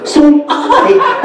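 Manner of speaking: high gasp, then a slow, squealed "So am I"; thrilled squeal. Retold or imitated. Woman thrilled that a stranger is going to Italy, squealing.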